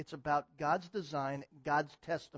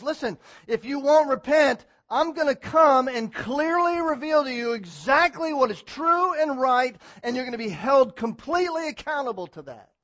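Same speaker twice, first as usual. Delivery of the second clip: God's warning; 8 semitones higher, 14 dB louder than usual. Retold or imitated. God warning the unrepentant church it will be held accountable, loud. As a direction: speak as God laying down the law. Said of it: "This is what God says".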